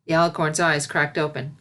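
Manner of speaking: plain reading voice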